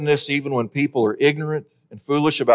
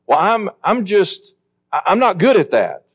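higher-pitched, halting imitation of an excuse-maker; mock excuse; 7 semitones higher than usual. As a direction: stammer out a lame excuse. Acts out a believer's excuse.

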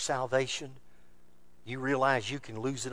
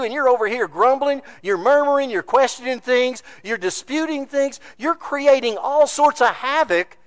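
rising, louder pulpit preaching; preaching at full force; 12 semitones higher, 13 dB louder than usual. Acted. Preacher warning the congregation, in full pulpit force. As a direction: drive it home to the congregation.

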